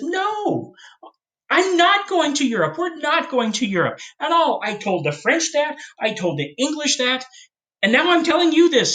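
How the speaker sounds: a hint of an exasperated president; Washington's voice. History teacher voicing Washington, high-pitched and exasperated.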